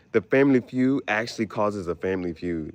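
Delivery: ordinary talk, nothing marked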